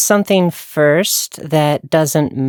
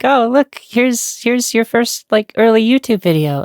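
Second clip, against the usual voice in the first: mock cheerful reminder; 7 semitones higher than usual. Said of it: "it was like".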